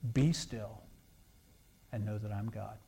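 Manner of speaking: a hushed reading of the verse; soft scripture quote. Acted. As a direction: let the verse settle into stillness. Quoting Scripture softly.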